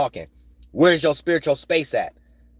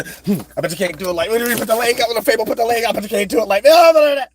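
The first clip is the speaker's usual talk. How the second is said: high, fast mocking voice of a show-off; mocking imitation; 8 semitones higher, 4 dB louder, faster than usual. Mocking a show-off who puts his leg on the table, high and fast.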